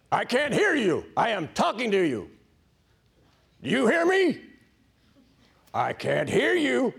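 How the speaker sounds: loud, high-pitched Marine drill shout; drill instructor. Acted. Harsh taskmaster God waking a tired recruit, drill-instructor yell. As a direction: bellow it like a drill sergeant at recruits. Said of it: "with a voice like this".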